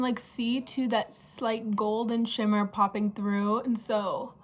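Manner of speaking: normal talking voice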